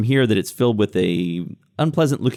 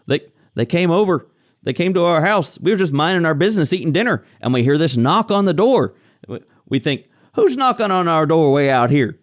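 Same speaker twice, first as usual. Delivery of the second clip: higher-pitched, rambling villager; nervous captive farmer; 5 semitones higher than usual. Acted. Captive man recounting the knock at his door, rattled. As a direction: tell it as a rattled homesteader.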